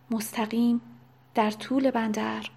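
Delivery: usual speaking voice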